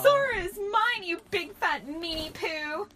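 high, loud, drawn-out tantrum; hammed-up screamed taunt; childish scream. A narrator's reading. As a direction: shriek the insult like a possessive kid. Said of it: "screamed Kairi at Hillary".

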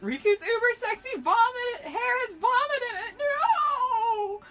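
high, loud hammed-up wailing; screamed in horror. A narrator's reading. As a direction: build to a long anguished shriek. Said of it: "We all screamed in unison".

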